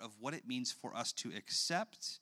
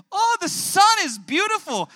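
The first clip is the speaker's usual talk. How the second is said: much higher and far louder, squealing with wonder; delighted toddler; 19 dB louder than usual. Retold or imitated. Father voicing his daughter marvelling at the sunset. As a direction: gasp at the sunset like a thrilled little girl.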